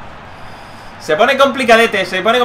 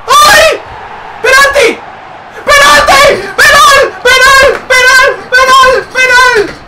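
much higher, very loud repeated cries; frantic shouting; 14 semitones higher, 6 dB louder, slower than usual. Real, not acted. Streamer gets a penalty in the final, screaming. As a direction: scream penal over and over in disbelief.

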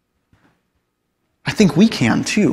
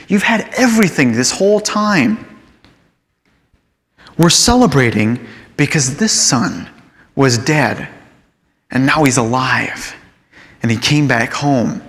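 gentle father; 4 semitones lower than usual. Acted. Father answers resentful son gently.

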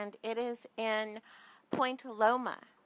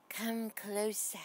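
slow unvoiced spider whisper on "Come closer"; spooky whisper; slower than usual. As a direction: lure them in with a breathy hiss. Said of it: "She's saying".

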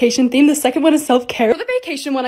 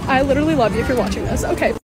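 ordinary talk

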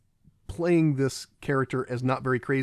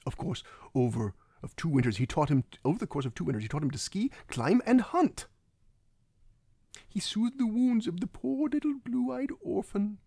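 hushed, mock-theatrical villain storytelling, rising high at the end; Christoph Waltz; 7 semitones higher than usual. Villain recounting his father teaching the boy, theatrical impression. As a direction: draw out the last phrases in a high, thin mock villain voice. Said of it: "my problem with Christoph Waltz is".

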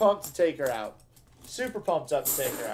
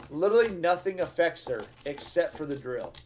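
normal talking voice

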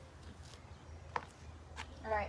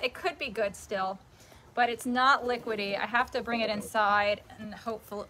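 usual speaking voice

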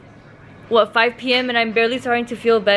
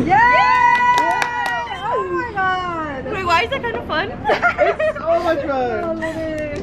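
excited; 9 semitones higher, slower than usual. Real, not acted. Having fun at bracelet booth.

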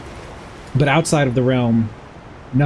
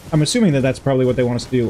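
usual speaking voice

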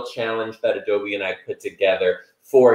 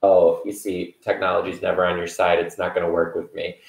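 ordinary delivery

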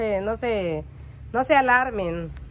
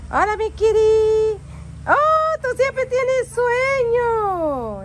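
high, slow cooing pet voice; much higher and louder sweet talk to the cat, drawn out; 12 semitones higher, 4 dB louder, much slower than usual. Real, not acted. Cooing to her sleepy cat.

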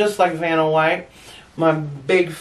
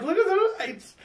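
much higher, softer, in wonder; stoned patient marvelling at the lights; high, hushed amazement; dazed wonder; 13 semitones higher, 5 dB quieter than usual. Retold or imitated. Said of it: "I'm like".

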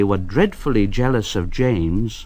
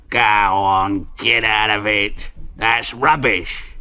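lightly voiced foreman; 2 semitones lower than usual. A narrator's reading. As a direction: wave it away as nonsense. Foreman scoffs at workmen.

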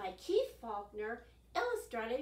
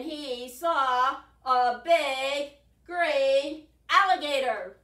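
dramatic build; 3 semitones higher, 15 dB louder, much slower than usual. A narrator's reading. Narrator builds suspense.

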